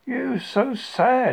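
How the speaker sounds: Pooh's pitying remark; concerned bear. Acted.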